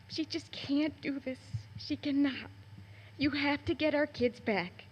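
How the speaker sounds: pleading through rising emotion; choked-up plea; emotional. Real, not acted. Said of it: "I'm sorry I get emotional".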